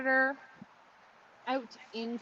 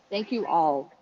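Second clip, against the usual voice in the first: ordinary talk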